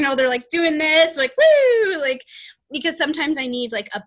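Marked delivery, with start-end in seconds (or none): 1.4-2.0 loud, high-pitched cheering "woo"; playful whoop. Retold or imitated. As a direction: whoop like playful guides celebrating. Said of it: "they're playful".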